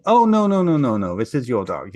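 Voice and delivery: loud high burst, dropping to normal; hasty backtracking. Retold or imitated. Farmer on hearing of a money reward, hasty backtracking. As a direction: backpedal with a hammed-up "oh no".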